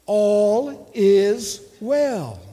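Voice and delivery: slow, drawn-out "All is well"; measured declaration. Shown as a demonstration. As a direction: lead the congregation slowly, each word held. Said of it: "Say it like you believe it".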